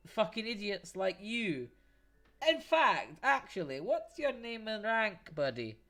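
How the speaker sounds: angry guard caught off guard; guard sputtering back at the dwarf; flustered outrage. Acted. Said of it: "he's like".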